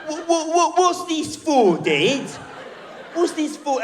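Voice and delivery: put-on high, slow, loud child whine; whiny British kid. Son tugging in the bath pesters dad in high kid voice. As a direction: stretch it like a nagging small boy. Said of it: "He's still got the annoying British accent".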